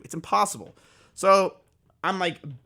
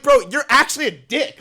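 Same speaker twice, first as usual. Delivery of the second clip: exasperated; 7 semitones higher, 6 dB louder than usual. Retold or imitated. Reacting to breakup tweet.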